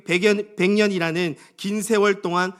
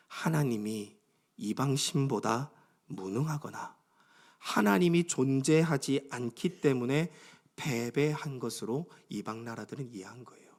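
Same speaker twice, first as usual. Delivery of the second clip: ordinary delivery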